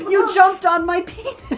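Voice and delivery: quoting the gif guy, a high laughing outraged squeal. Retold or imitated. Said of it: "and he's like".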